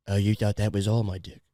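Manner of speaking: low, soft British dirty talk; British accent. Acted. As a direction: murmur seductively in a mock British accent. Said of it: "keep just going into U K accents".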